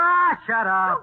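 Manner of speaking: loud, high, drawn-out angry snap; angry outburst. Acted. Husband snaps at nagging wife, angry and loud. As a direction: shut her up in a burst of temper.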